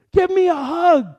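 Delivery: loud, high burst of joy; retold driver shouting for a hug; excited call for a hug. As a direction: belt it out like a man overjoyed. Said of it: "he's like".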